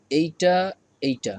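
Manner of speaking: normal talking voice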